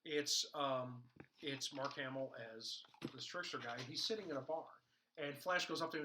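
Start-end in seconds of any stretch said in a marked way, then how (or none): none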